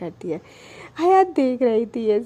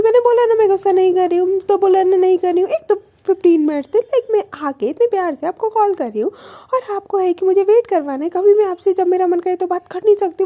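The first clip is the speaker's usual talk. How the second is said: mock outburst, higher, louder and rushed; retold angry yelling; 5 semitones higher, 4 dB louder, faster than usual. Alisha snaps at Anu after waiting, retold rapid yelling. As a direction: fire off the complaint in one breath, half-shouting. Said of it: "Alisha चिल्ला जाती है कि".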